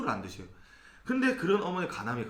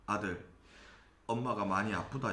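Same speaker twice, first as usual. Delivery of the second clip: dad's low voice; 7 semitones lower, 6 dB quieter than usual. Retold or imitated. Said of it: "그런 목소리를 가지셨는데".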